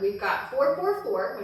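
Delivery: ordinary delivery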